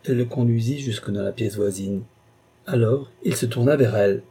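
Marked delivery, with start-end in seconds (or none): none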